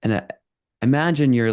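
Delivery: usual speaking voice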